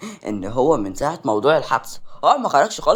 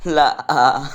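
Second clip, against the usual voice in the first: a sharp, much louder no; loud refusal; 9 dB louder than usual. Acted. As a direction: shut her down firmly. Flat refusal at the mall. (رفض حاسم بصوت عالٍ)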